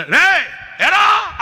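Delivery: high loud shout; shouting at the boy. Retold or imitated. Preacher shouting at the boy by the generator, high and loud. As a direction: yell it across the yard. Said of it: "అన్నాను".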